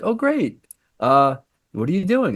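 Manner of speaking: high, friendly small-talk voice; imitated co-star. Co-star chatting to a newcomer, imitated in a high voice. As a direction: put her replies up high, politely interested. Voicing co-star's friendly reply.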